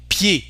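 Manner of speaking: pied said as "pyay", final D silent; French closed e. Shown as a demonstration. Teacher showing I and E, French closed e. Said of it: "Usually we say E".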